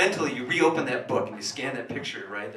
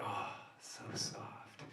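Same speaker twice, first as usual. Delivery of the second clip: breathy whisper; 5 semitones lower, 17 dB quieter, much slower than usual. Acted. Savoring her bare back.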